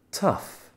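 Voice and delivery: tough said as English "tuff". Shown as a demonstration. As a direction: model tough for learners. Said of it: "on écoute [name]".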